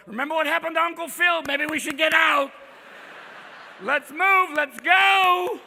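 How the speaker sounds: loud, high, rising panic to flee. Acted. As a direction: hurry everyone out in alarm.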